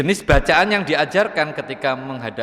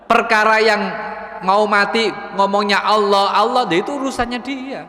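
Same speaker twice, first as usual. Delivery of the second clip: raised emphatic voice; 7 semitones higher than usual. Real, not acted. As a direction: lift the voice to drive it home. Preacher raising voice in sermon.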